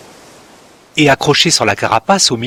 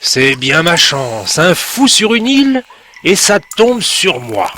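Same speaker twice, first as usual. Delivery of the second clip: put-upon crab, rising into a louder, higher whine; grumpy crab complaining; 5 semitones higher, 4 dB louder than usual. A narrator's reading. The grumpy crab complains about meeting Timek, whining higher. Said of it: "le crabe grognon".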